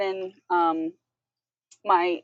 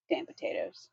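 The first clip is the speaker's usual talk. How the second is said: mock grumble; 13 semitones lower, 8 dB quieter than usual.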